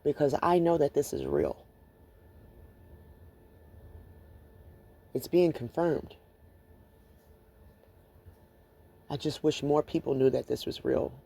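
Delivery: subdued, with long pauses between thoughts; real emotion, fading toward a near-whisper; emotional, holding back. Real, not acted. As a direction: gather yourself quietly to carry on. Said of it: "I just get very emotional".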